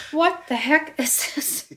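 acted-out bewildered reaction; baffled. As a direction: stretch it out in retold disbelief. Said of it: "I'm looking at it going".

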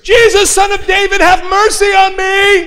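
high, sustained cry on one pitch; mock yell, high without added volume; imitated shouting. Woman shouting her formula at Jesus, imitated loud cry. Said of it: "shouting out as loud as she can".

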